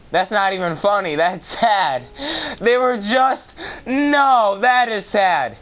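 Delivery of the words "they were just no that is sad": lamenting whine. Real, not acted. Lament, higher and louder.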